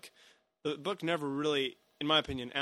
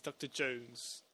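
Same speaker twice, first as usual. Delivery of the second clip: "Doctor Jones" slow and softer, Russian with British vowels; British-slipping Russian accent; 2 semitones lower, 8 dB quieter, much slower than usual. Shown as a demonstration. Contrasting Cate Blanchett's take, soft and slow with British vowels. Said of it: "but she says".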